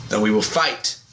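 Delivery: lightly deepened hero voice; Al Sahim. Retold or imitated. Oliver as Al Sahim challenging Nyssa, lightly deepened. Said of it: "he does more of a deeper voice".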